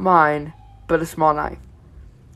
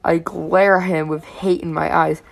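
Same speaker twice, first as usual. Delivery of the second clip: usual speaking voice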